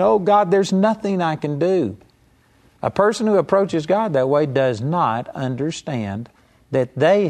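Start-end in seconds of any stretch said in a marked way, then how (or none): 0.0-2.0 higher, whiny imitation of begging; mock crying plea. Preacher imitates a believer begging God, whiny higher plea. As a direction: play helpless, begging God. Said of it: "crying and begging".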